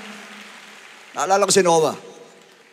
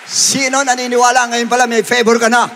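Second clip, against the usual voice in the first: raised, projected preacher's question; rallying call; 6 semitones higher, 7 dB louder than usual. Real, not acted. Preacher asks who believes in favor, rallying call. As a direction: call it out to the whole congregation.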